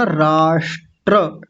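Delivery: राष्ट्र stretched slowly, ट्र clear; slow demo. Shown as a demonstration. Teacher showing the pronunciation to students. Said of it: "उच्चारण कैसे होगा?".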